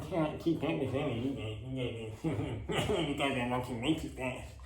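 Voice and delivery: put-on limping kid, breaking into a laugh; mock limping kid. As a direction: play the straggling kid, half laughing. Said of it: "German kid who limped his way back".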